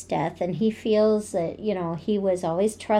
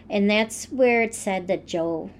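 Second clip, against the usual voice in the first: ordinary delivery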